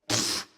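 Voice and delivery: short puff of disdain; dismissive scoff. Acted. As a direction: blow out a quick pfft. Scoffs at being called a cuff.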